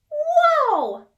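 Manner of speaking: very high, loud awestruck exclaim; amazed whoa. Acted. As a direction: gasp it out in wonder. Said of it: "said Porcupine".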